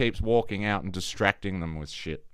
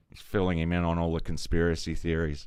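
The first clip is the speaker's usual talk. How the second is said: ordinary talk, nothing marked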